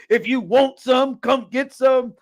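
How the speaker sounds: mocking the catchphrase. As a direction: ham up the tough-guy line lightly. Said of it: "do you go to written things like".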